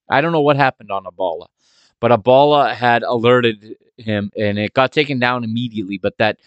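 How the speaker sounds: ordinary talk, nothing marked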